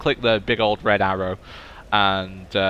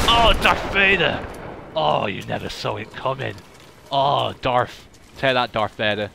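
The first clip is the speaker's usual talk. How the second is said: gleeful taunt. Real, not acted. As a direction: rub the win in his face. Taunting a killed enemy player.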